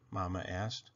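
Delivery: ordinary delivery, nothing marked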